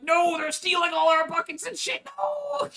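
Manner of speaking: very high squealing despair; hammed-up anguished protest; mock wailing cry. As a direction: whine it like a robbed child. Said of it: "Bolognese were like".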